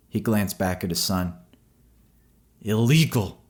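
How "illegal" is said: higher, louder spat insult. A narrator's reading. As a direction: spit the word illegal at the man. First of two takes.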